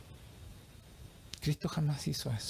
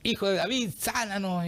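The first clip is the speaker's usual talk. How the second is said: calling from afar; 5 semitones higher than usual. Acted. Blind men crying out to the Lord from afar, acted calling out. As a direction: call out in a drawn-out mock shout. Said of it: "unos ciegos le gritan de lejos".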